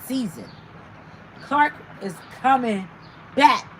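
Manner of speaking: normal talking voice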